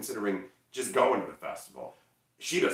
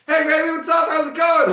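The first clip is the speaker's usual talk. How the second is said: very high, booming mock greeting; loud, over-the-top excited holler; full of energy; 12 dB louder than usual. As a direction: bound in shouting an exuberant hello. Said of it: "come bounding out full of energy".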